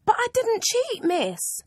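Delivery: put-on high, desperate girl's protest; protesting schoolgirl. Pupil protesting to her teacher, high and desperate. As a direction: insist on innocence, almost pleading.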